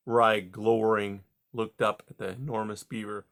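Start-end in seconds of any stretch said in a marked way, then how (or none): none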